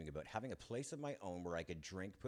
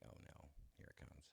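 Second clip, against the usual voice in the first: breathy whispered dread, much softer; 3 semitones lower, 17 dB quieter than usual. Retold or imitated. Boy bracing as mother starts to scold, whispered dread.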